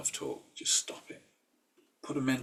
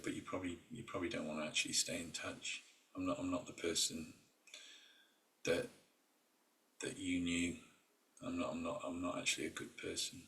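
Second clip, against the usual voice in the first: much softer, lower, withdrawn voice; subdued confession; 4 semitones lower, 9 dB quieter than usual. Retold or imitated. Imitating an old college friend confessing on Facebook, soft and subdued. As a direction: hang your head and admit it quietly.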